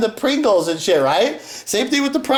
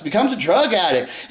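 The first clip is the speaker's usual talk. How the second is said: ordinary delivery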